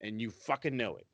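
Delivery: usual speaking voice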